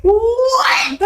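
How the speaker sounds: laughing shout. Real, not acted.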